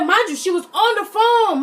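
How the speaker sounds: "she was on the phone" pitched high and loud; emphatic aside. Real, not acted. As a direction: drive home "on the phone" in indignation. Indignant aside stressing key detail.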